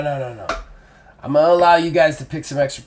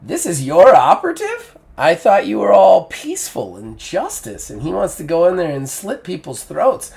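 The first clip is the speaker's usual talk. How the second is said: teasing, a bit higher and louder, amused disbelief; mocking; 5 semitones higher, 3 dB louder than usual. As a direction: needle him with amused mockery. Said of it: "She looks at Arn and goes".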